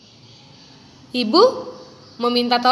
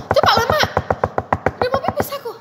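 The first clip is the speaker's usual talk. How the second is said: put-on much higher, urgent whiny kid; desperate bratty plea; impatient rude child; 13 semitones higher, much faster than usual. Shown as a demonstration. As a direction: whine at the bathroom door. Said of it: "mengucapkannya tidak sopan".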